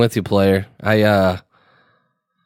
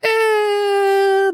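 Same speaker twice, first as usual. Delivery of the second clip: very high, odd-sounding eh; dismissive eh. Retold or imitated. Brushing off his wife's dinner plan, very high eh. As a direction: squeak out a high reluctant eh. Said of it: "And I was like".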